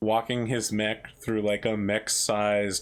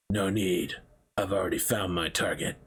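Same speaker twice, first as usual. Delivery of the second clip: bounty hunter figure; 3 semitones lower than usual. Acted. Armored guy answers the Immortal Warrior in a bar, low and menacing. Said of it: "And the other guy goes".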